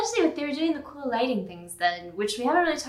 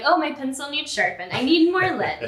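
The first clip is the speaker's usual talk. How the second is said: higher, louder fake-innocent excuse; mock excuse; 5 semitones higher, 6 dB louder than usual. Student dodging the teacher, a mock bright excuse. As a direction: sell the stalling, raising the voice.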